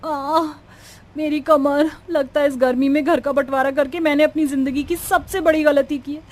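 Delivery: acted distress swelling at the end; tearful despair. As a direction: lament your sore back and mistakes, close to tears. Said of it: "Alka जोर-जोर से रोने लगती है".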